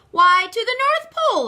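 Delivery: high, loud and grand; a big, jolly conductor's answer; booming conductor. Acted. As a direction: announce it like a proud conductor.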